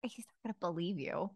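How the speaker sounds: hushed warning to a friend; whispering. Acted. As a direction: keep it soft and low, under your breath. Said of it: "You can hear us whispering".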